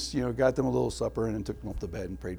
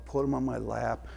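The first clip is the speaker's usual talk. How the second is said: ordinary delivery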